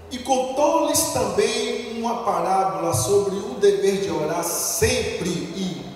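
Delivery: each word drawn out slowly for the church; solemn, slow verse reading; slow scripture reading. Shown as a demonstration. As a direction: read it slow and weighty. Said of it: "Repete assim comigo".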